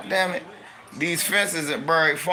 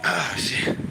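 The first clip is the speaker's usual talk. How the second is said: breathy, voiceless muttered curse; cold-struck gasp; 5 dB quieter than usual. Real, not acted. Reacting to the cold.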